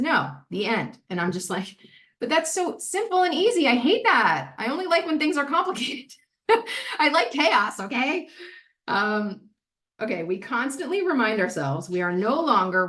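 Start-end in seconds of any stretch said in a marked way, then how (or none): 2.2-8.3 high-pitched mock complaint with a laugh; playful indignant whine; whiny protest. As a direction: protest like a put-out kid, pitch swooping up. Acting out her own protest.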